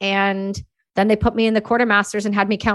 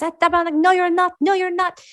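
higher Québécois insistence; French-Canadian sergeant; 8 semitones higher than usual. Retold or imitated. Sergeant contradicts her.

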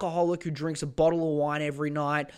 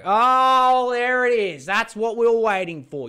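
loud, higher mock celebration; sarcastic cheer; 7 semitones higher, 9 dB louder than usual.

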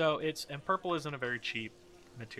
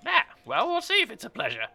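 high, loud sceptical character voice; Harold Pisces, little guy; 13 semitones higher, 7 dB louder than usual. Acted. Little guy doubting the newcomer, high and loud. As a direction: answer warily, pitched well up. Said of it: "the little guy".

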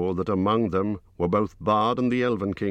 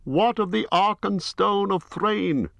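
much higher, slow, formal greeting; Bard the bowman; 3 dB louder than usual. A narrator's reading. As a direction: raise the voice high for a grave herald-like Bard. Bard reveals the stone.

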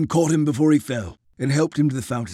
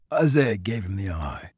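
ordinary delivery

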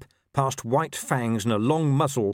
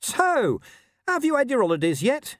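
high, louder frightened little squeak; dog making small talk with wolves, squeaking high; 4 dB louder than usual. A narrator's reading. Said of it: "he squeaked".

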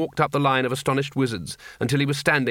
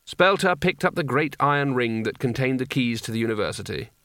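ordinary delivery

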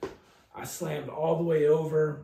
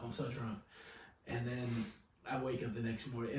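normal talking voice

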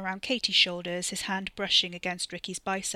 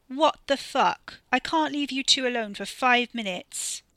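loud, exasperated scolding; 5 semitones higher, 8 dB louder than usual. Acted. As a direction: tell them off in disbelief. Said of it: "She wasn't impressed".

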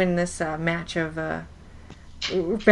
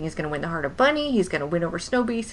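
normal talking voice

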